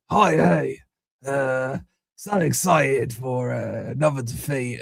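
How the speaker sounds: put-on Irish voice, slow and hesitant; attempted Irish accent. Streamer redoing a viewer's message on request, attempted Irish accent. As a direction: have a halting go at an Irish accent. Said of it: "I'm doing a Irish voice".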